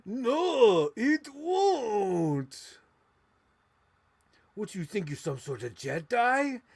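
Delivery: high, loud put-on movie voice; Star Wars character voice. Playing with Star Wars toys, loud high villain voice. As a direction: ham up a sneering villain.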